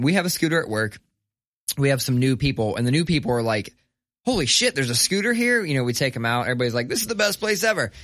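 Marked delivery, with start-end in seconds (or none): 4.2-5.6 amazed. Retold or imitated. New coworkers amazed. 6.9-7.9 delighted. Retold or imitated. Coworkers delighted.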